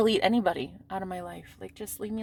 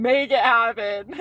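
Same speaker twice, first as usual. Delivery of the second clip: said louder and slower with a laugh; louder laughing affirmation; 6 dB louder, slower than usual. Shown as a demonstration. Urging herself on, louder and laughing. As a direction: punch it out like a mantra. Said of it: "repeated affirmation, louder".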